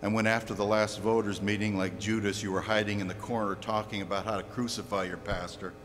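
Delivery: very low, softened preaching; grave hushed rebuke. Real, not acted. Preacher turning on listeners who blame their pastor, grave and hushed. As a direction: drop right down and warn them quietly.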